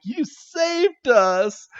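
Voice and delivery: put-on high, slow, gushing kid voice; grateful kid. Imitating Ash thanking Bruno, high slow gushing kid voice. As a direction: gush it like a rescued boy. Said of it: "he's like".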